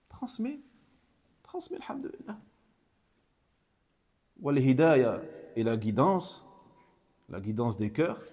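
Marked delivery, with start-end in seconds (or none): 0.1-2.4 whispered, barely voiced; soft whisper. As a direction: breathe the word out. Hushed repeated instruction. (chuchoté doucement)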